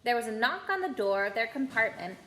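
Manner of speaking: usual speaking voice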